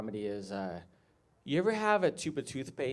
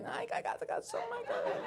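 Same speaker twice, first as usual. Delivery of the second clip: very high, soft, squeezed voice; mock straining, thin falsetto; strained squeaky husband; 9 dB quieter, faster than usual. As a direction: squeak it out under effort. Husband straining again with toothpaste.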